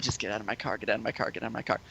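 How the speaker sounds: very soft, low repeated plea; muttered inner plea. Retold or imitated. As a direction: mutter it under your breath like a desperate wish. Inner plea as he drops her.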